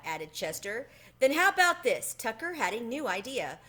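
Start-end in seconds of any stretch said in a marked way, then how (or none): 1.2-2.1 eager. Acted. Tucker pitches an idea.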